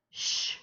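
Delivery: "sh" as one sustained hush sound; isolated SH sound. Shown as a demonstration. Teacher introducing the consonant digraph, "sh" as a pure hiss. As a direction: say "sh" as a single consonant, no vowel. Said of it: "The pattern is consonant digraph".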